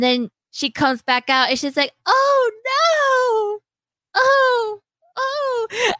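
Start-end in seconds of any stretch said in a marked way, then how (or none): 2.1-5.9 high crying mock panic; panicked wail. Selner sees his endless potential, high crying wail. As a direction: wail like a terrified healer.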